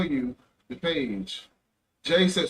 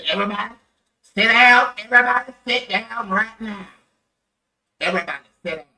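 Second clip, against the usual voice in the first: loud, high commanding shout; Officer Patrick; 5 semitones higher, 11 dB louder than usual. Retold or imitated. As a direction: imitate a big officer ordering a room to sit. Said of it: "That's Officer Patrick".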